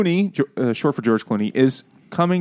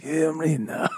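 hammed-up Greek accent, higher pitch; mock Greek accent; 4 semitones higher, 4 dB quieter, slower than usual. Repeating the joke name, mock Greek accent. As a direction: say it like a pretend Greek. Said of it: "That's what they call him in Greece".

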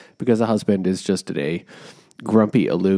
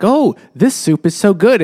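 high, louder mock rave about the soup; gushing guest; 9 semitones higher, 6 dB louder than usual. Dinner guest praising the soup, hammed-up high gush. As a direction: ham up a delighted dinner guest. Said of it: "'cause she's like".

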